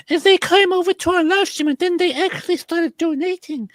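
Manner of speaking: mocking high voice of a streamer begging for donations; mocking imitation. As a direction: parody him as a greedy streamer. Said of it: "started twisting it into".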